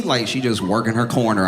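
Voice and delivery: usual speaking voice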